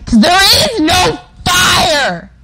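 high, loud playful scream. Real, not acted. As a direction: yell it out for fun. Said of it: "Am I screaming too loud".

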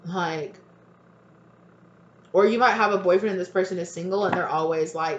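ordinary talk, nothing marked

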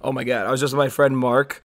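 usual speaking voice

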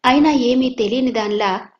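normal talking voice

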